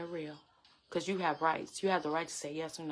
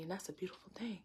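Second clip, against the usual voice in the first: hushed awe; 12 dB quieter, faster than usual. Real, not acted. Reflecting in awe on testimony.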